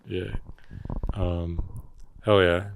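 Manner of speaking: normal talking voice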